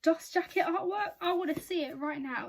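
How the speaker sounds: excited surprise. Real, not acted. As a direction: react with eager, loud amazement. Surprised by jacket artwork, live reaction.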